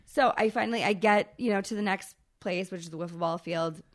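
ordinary delivery

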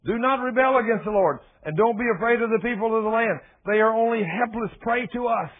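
loud, high, fervent preaching; preaching at full force. Acted. Preacher exhorting his church, loud and fervent. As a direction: proclaim it to the congregation.

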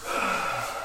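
long soft breath out; breathing coach showing the exhale; demo exhale. Shown as a demonstration. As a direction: let the breath all out. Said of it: "You're going".

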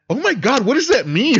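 high, loud mock-shocked little sister; imitated shock. Little sister reading over a shoulder, imitated loud shock. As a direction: blurt it out high and loud, hammed up.

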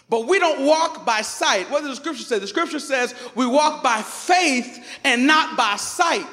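loud, slow, rising preacher's cadence; preaching at full force. Acted. Pastor driving the point home to his congregation, loud and slow. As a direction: lean into the sermon, pulling the room along.

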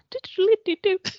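high, playful drum-fill vocal noise; little drummer imitation. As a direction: mimic a tiny drum roll with the mouth. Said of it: "Looks like a little drummer".

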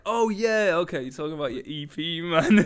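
high, loud mock realisation; sudden loud recognition, amused; crowd catching on. Voicing people who clocked the song title, mock excited. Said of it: "'cause people clocked".

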